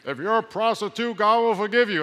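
high, loud altar-call announcement; reluctant loud proclamation. Retold or imitated. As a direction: blurt it out loudly to the whole arena. Gives in, announces it from stage.

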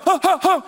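high, punched "huh" bursts; imitated huffing noise. Storyteller replaying the dude falling down, high huffs. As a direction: mimic repeated knocks to the body. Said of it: "So the dude falls down".

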